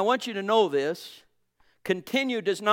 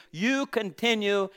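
mild emphatic quote; 3 semitones higher, 7 dB louder, slower than usual. Acted. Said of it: "he says so sweetly, so specifically".

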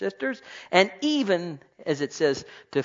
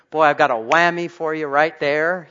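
louder, cocky comeback imitated; mock smart retort; 9 dB louder than usual. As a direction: play the sharp-tongued know-it-all. Mocking a quick-tongued retort.

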